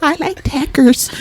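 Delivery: high, badly faked American accent; mock American accent. Mocking how she thinks Americans talk, botched American accent. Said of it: "Is that how you think we talk?".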